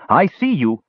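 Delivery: put-on high, gleeful little boy; happy cry. A narrator's reading. Little boy spotting Tanis and Brandella, crying happily. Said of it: "he cried happily".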